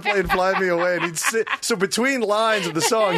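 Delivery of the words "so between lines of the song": normal talking voice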